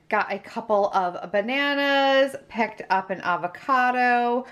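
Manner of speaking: normal talking voice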